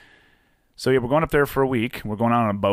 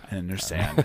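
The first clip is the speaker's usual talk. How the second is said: laughing; 5 dB quieter, slower than usual. Real, not acted. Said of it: "echoing the joke slowly".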